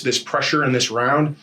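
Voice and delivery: ordinary delivery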